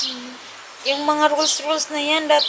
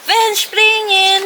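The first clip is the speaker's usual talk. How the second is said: imitating her; 8 semitones higher, 4 dB louder, much slower than usual. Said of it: "Sabi niya sa akin".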